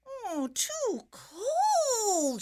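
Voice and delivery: long, drawn-out high whine, loud; loud, high, wailing complaint; whining. A narrator's reading. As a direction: whine it slowly and high like a fussy little girl. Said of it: "she whined".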